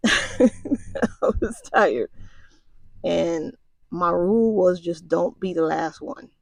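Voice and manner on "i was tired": slow, laughing confession; laughing admission. Real, not acted. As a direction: laugh it out, drawn out. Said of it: "I ain't gonna lie to you".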